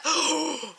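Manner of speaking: high startled gasp; sharp gasp. Acted. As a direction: catch your breath in shock. Realizes where the guy stopped.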